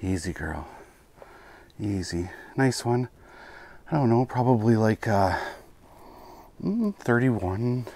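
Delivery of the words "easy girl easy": soft, low calming voice; soothing a scared animal. Real, not acted. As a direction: gently settle a frightened fish. Calming fish on release.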